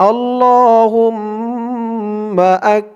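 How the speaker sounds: slow chanted recitation for repeating; recite along. Shown as a demonstration. Teacher asks viewers to say it with him, slow chant. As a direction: intone it slowly so the class can follow. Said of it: "আমার সাথে বলুন".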